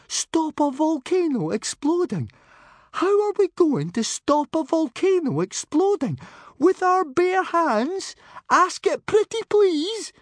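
very high, loud, squeaky protest; panicked disbelief. A narrator's reading. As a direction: squeal your sarcasm in rising panic. Timid friend panics at the plan.

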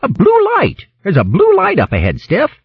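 high, urgent shout of discovery; excited call back. A narrator's reading. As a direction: call the news back down the line, pitched high. Leader calls back news of light.

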